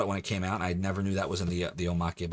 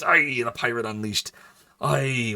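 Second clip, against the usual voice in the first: louder put-on pirate accent; mock pirate accent; 3 semitones higher, 4 dB louder, slower than usual. Streamer trying out an accent for chat, pirate accent. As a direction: lean into a hammy pirate accent. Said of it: "what does that accent sound like".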